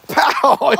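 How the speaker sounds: a high, loud mock punch noise; punch sound. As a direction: smack it out like a fist landing. Boy punches masked man.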